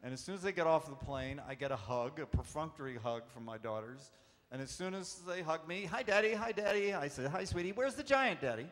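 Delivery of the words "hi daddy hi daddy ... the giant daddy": put-on high, brisk little girl greeting; eager child voice. Dad imitates daughters at the airport, high and eager. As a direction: blurt it out like an impatient kid.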